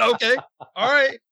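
high, meek mock concession; backing down. Manager gives in to the insistent wrestler, high-pitched placating. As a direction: give in quickly, pitch way up.

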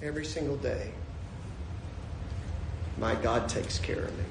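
soft, low, reverent statement; quietly sincere. Acted. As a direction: let it sink in tenderly, with a long pause. Same words repeated softly after exultation.

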